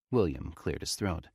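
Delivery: usual speaking voice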